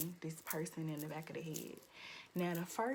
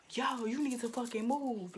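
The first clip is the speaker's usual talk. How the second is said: louder, higher fed-up demand; retold confrontation; 4 semitones higher, 5 dB louder than usual. Speaker retells warning a stranger crowding her, fed up. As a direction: tell them off, pitched up and forceful.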